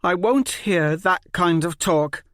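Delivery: high, loud forced firmness; feigned strength. Acted. Polly Mott scolds the quarreling kids with forced firmness. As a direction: try to sound strong. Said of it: "a feigned strength in her voice".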